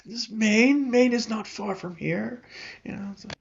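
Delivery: high, slow protesting voice; defensive high voice. Acted. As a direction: squeak out a flustered excuse. Fishmonger protests high-pitched.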